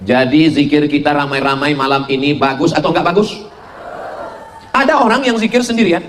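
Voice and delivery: ordinary delivery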